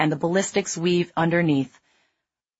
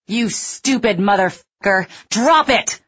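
furious loud, high bellow; 5 semitones higher, 5 dB louder than usual. A narrator's reading. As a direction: rage at him. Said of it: "Sykes bellowed".

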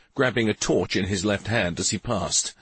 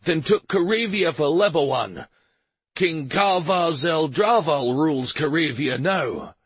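ranting shout; 7 semitones higher than usual. A narrator's reading. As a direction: rave it out as he works himself up. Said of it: "He was shouting by the time he finished".

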